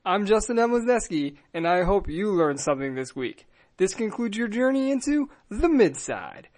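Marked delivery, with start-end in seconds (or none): 3.8-6.5 slow, measured sign-off read; podcast outro. Acted. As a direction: close the show gravely and slowly.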